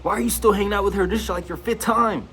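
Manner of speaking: high, fast, nagging friend voice; mocking friends. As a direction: mimic pushy friends, high and rushed. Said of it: "They like".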